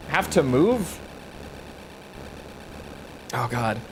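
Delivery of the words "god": normal talking voice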